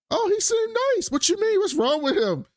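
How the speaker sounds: very high mock-innocent voice; naive crew members. Crew defending the robot as nice, high puzzled voice. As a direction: imitate puzzled defenders in a squeaky-high pitch.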